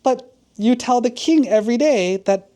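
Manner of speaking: high-pitched, puzzled protest; confused father. Acted. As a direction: question your son in acted bafflement. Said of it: "Now the father's confused".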